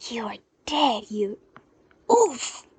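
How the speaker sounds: breathy threat ending in a pained oof; growled threat then winded grunt; threat cut off. Acted. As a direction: get hit mid-sentence.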